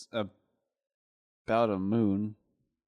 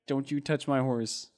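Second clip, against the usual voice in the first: ordinary delivery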